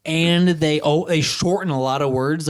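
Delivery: normal talking voice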